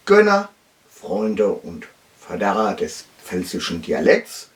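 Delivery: normal talking voice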